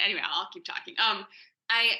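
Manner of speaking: usual speaking voice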